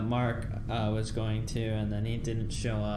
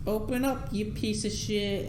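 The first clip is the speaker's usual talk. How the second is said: mom yelling; 9 semitones higher than usual. Retold or imitated. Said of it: "then his mom's like".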